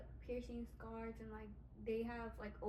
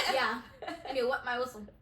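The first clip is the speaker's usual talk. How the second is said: laughing; 12 dB louder than usual. Real, not acted. Laughing at the joke.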